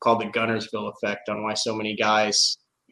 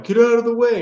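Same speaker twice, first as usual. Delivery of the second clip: loud, high barked order; mock boater yelling; angry yell; 10 semitones higher, 8 dB louder than usual. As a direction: shout them out of the way.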